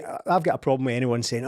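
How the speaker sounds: ordinary delivery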